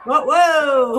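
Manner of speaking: loud, drawn-out whoa; wowed fan calling out; excited reaction. Real, not acted. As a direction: react with a real, loud burst of amazement. Host reacting to wrestling finish.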